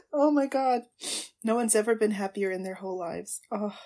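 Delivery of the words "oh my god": ordinary delivery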